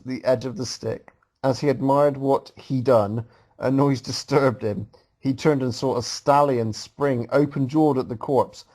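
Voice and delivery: ordinary delivery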